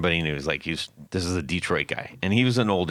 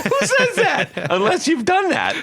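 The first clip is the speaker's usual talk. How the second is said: very high, slow laughing question; laughing squeal; 4 dB louder, slower than usual. Real, not acted. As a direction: squeak it out through real laughter. Laughing at the quote.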